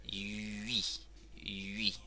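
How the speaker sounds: YUI slow and soft, French u gliding to i; il più lento possibile. Shown as a demonstration. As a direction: draw out YUI softly for learners. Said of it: "Voy lo más lento posible".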